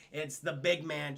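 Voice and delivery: usual speaking voice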